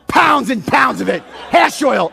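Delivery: loud, punched-out sarcastic list; livid. Retold or imitated. Livid applicant answering the marijuana question. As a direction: belt it out as an acted fed-up retort. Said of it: "I'm livid".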